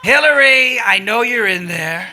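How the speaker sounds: impression hailing someone over a bullhorn; Donald Trump. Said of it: "yelling at her through the bullhorn".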